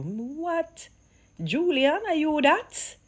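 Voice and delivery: can't believe what he heard; stunned, high-pitched disbelief; amazed. Real, not acted. Amazed at the exchange.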